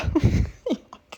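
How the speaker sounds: a short, high, breathless burst of laughter; breathy laugh. Real, not acted. Vlogger cracks up at Atish's joke, airy laugh. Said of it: "हंसी आ गई".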